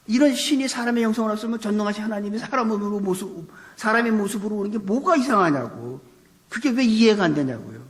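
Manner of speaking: pitched high, indignant challenge; exasperated. Real, not acted. Preacher challenging those who cannot believe Jesus came as man, exasperated. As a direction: press the point in real, incredulous exasperation.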